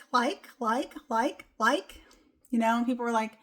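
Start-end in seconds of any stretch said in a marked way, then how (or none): 0.1-2.0 a hint of a ditzy Valley girl fumbling for words; lightly voiced nervous Valley girl stammering like, like; mild Valley girl filler, nervous and stuck. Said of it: "I used to talk like a Valley girl".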